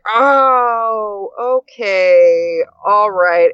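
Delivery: acting fine while rattled; forced calm. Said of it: "so I went in like".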